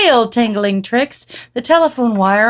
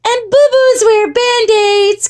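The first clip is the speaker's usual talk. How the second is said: put-on high, loud girlish chant; little sister; 14 semitones higher, 5 dB louder than usual. A narrator's reading. Jezebel continuing the sisters' list, high singsong. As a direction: carry on the child's singsong. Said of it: "continued Jezebel".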